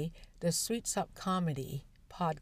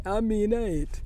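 louder imitated Jamaican patois; Jamaican accent; 3 semitones higher, 5 dB louder than usual. Imagined end of the accident story, mock Jamaican accent. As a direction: mimic a Jamaican man talking. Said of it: "this Jamaican accented gentleman speaking".

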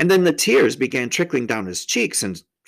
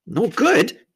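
higher, louder startled question; alarmed young girl's voice; frightened; 10 semitones higher, 5 dB louder than usual. A narrator's reading. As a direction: cry it out in sudden fright. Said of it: "jumping up and feeling rather frightened".

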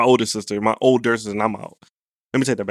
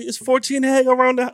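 mocking sister; 9 semitones higher than usual. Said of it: "I like making fun of my sister".

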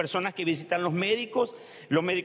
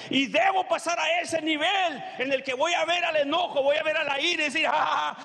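raised, shouting preacher voice; fiery preaching; 5 semitones higher than usual. Acted. Preacher commanding the congregation to cast off anger, shouting. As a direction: preach it hard, rising to a shout.

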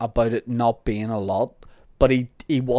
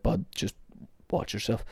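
hushed warning, much softer; mock threat; 9 dB quieter than usual. Warning the listener, quiet mock menace. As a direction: murmur it like a veiled threat.

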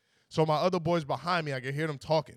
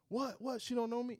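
higher, softer imitation of a baffled guy; mock disbelief; 7 semitones higher, 7 dB quieter than usual. Kid told that she doesn't know him, mock disbelief. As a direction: imitate someone taken aback, pitched up. Kid acts shocked at being disowned.

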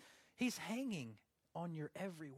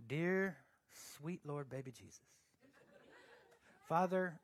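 mock prayer; 3 semitones lower than usual. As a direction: parody a solemn pray-er, pausing after each title. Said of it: "that's how you pray".

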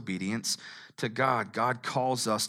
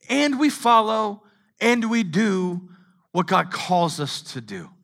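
loud, high, driving preaching; loud sermon climax; full-force preaching; 9 semitones higher, 7 dB louder than usual. Acted. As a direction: preach it hard to the room.

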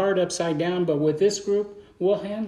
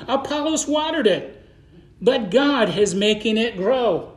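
preaching scripture; 4 semitones higher than usual. Acted. Reading scripture aloud, slow and emphatic.

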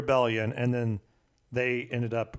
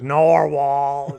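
put-on drunk slurring soldier; 8 dB louder than usual. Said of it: "There's these soldiers".

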